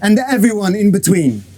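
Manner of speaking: booming ring announcer; big showman intro voice; lucha libre announcer. Acted. Announcer opening an awards show.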